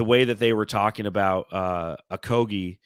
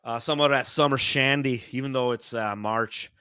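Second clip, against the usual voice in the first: put-on chirpy, sing-song Minnesota accent, pitched higher; a light Minnesota accent, raised and louder at the start; 4 semitones higher, 4 dB louder than usual. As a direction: play a cheerful Minnesota local up north. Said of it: "I don't know if that's a good Minnesota accent".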